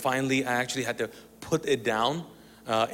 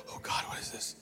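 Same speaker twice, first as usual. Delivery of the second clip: hushed, frightened whisper; scared whisper; 10 dB quieter than usual. Acted. Alone in the room hearing noises, scared whisper. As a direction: whisper as if every sound is a threat. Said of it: "when you're scared".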